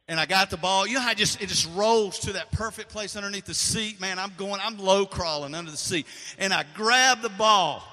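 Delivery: thrilled, loud storytelling; excited retelling. Retold or imitated. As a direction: relive the scramble for the ball. Reliving the catch excitedly.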